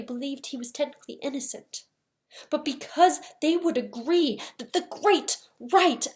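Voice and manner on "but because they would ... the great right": slow, louder, each key word punched; emphatic conviction. Acted. Lawyer on what the jury would agree, emphatic. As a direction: hit GREAT and RIGHT hard, spacing them out.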